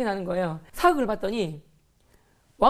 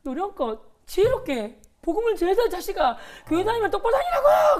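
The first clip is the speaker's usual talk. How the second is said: laughing shout; 9 semitones higher than usual. Retold or imitated. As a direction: yell the lecture, half laughing.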